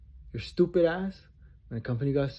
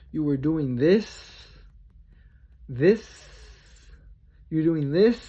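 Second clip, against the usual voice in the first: loud accusation; 2 semitones higher, 6 dB louder than usual. Real, not acted. Ranting accusation, rising loud.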